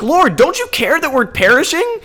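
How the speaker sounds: high, loud, frantic cry for help; panicked disciples. Retold or imitated. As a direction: beg like men about to drown.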